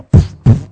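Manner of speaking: unvoiced loud thumping puffs; loud puffed heartbeat noises; heartbeat thumps. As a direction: mimic a pounding heart.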